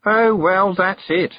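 high, loud, eye-rolling complaint; exasperated. A narrator's reading. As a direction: give up with loud disdain. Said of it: "rolling her eyes".

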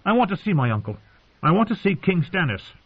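put-on high-pitched, determined child; stubborn boy. A narrator's reading. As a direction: state the demand like a stubborn boy. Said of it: "He got that stubborn look again".

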